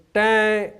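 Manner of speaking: loud, high "tang"; imitated band note. Bandsman showing how the band started off, imitated note. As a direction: blurt out a trumpet note. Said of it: "So note came".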